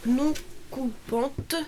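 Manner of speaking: slowly spelling out "non coupante"; slow and careful. Real, not acted. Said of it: "j'arrive pas à le dire".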